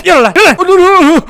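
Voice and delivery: high loud alarmed cries. Real, not acted. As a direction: shout in alarm as bombs fly. Alarmed as enemy attacks.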